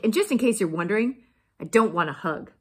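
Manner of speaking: loud, cross storybook voice; huffy cactus putting someone off; grumpy refusal. A narrator's reading. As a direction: refuse firmly and loudly.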